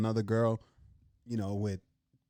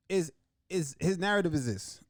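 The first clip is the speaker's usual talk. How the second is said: ordinary talk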